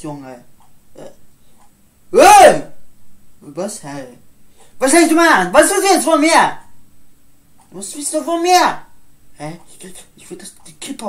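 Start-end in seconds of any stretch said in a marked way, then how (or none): 2.1-8.8 higher and much louder; furious yelling. Acted. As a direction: scream at him to back off. Fight escalating. (wütend geschrien)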